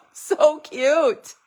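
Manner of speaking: high, loud, laughing "So cute"; laughing gush. Real, not acted. Crafter to viewers, laughing with delight at the bunnies.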